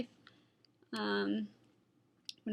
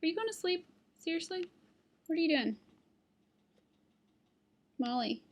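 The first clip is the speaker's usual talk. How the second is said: higher pet-talk; teasing dog talk; 6 semitones higher, faster than usual. Real, not acted. Vlogger teasing her sleepy dog in high pet-talk. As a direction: tease the sleepy dog gently.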